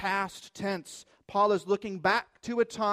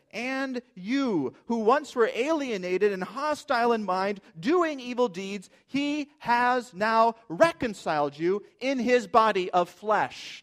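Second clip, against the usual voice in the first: raised, louder scripture reading, stressing "And you"; emphatic; 5 semitones higher, 6 dB louder than usual. Acted. Preacher reminding the congregation they are reconciled, emphatic. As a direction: declare it from "And you" onward, punching each phrase. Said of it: "it's very emphatic".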